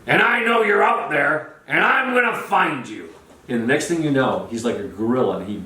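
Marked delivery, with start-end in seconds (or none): 0.1-3.1 high, drawn-out mock holler; calling out. Speaker warns the audience he'll find them, calling out. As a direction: imitate a showman calling out to the crowd, pitched up.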